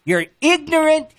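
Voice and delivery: high, hammered angry insult, said twice. Real, not acted. Caller insulting the radio host, high angry repeated jab. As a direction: jab the insult at him over and over. Heated on-air quarrel with host.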